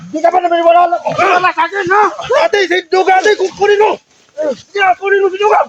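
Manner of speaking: high, agitated shouting; heated yelling. Real, not acted. Accused friend calls others to grab his attacker, agitated shout. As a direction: plead loudly to be believed and for help.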